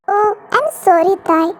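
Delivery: ordinary delivery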